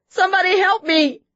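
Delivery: high, loud desperate scream. A narrator's reading. Wife screams for help over her husband, very high and loud. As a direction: scream for help at full force. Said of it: "she screamed".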